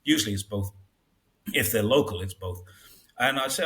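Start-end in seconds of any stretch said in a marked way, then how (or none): none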